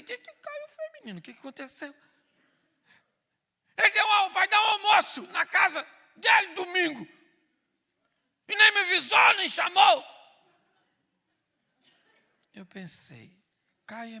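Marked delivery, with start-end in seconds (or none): 0.0-1.9 much higher, worried asking; high anxious question. Retold or imitated. Husband asking wife anxiously, high. 3.8-10.0 much higher, much louder outraged complaint; furious wife. Retold or imitated. Wife ranting to husband about their son, furious.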